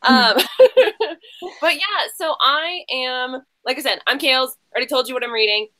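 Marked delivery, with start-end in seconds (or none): 0.4-1.1 sudden high giggle bursting out; high burst of laughter. Real, not acted. Host laughing at her own teasing of a guest, high giggle. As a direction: laugh high and bright at your own joke.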